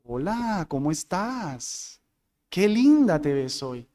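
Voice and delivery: imitating a soft, low come-on to a woman; mock flirting, softly lilted; flirtatious inflection. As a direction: draw the greeting out, low and suggestive. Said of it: "cuidados con estar inflexionando la voz para referirte a una dama".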